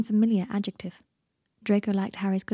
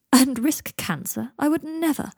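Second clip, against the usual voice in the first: slow, high, louder mock scandal; mock horror; 6 semitones higher, 4 dB louder, slower than usual. A narrator's reading. Draco joking with Seven, in exaggerated horror. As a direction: draw it out in theatrical affront. Draco jokes after laughing.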